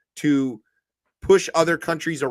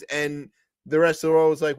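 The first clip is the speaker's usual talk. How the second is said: ordinary talk, nothing marked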